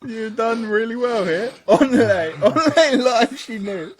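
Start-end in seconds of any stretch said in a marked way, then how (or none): 1.7-3.9 only said as "on-lay", high and laughing; mocking mispronunciation. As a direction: repeat only as "on-lay" teasingly. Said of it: "how you spell only".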